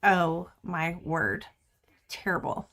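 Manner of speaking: mock-appalled aside, fading to a whisper; hushed mock dismay. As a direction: mutter it in mock horror, then whisper the last word. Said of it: "and I'm like".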